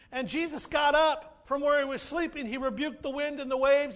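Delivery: usual speaking voice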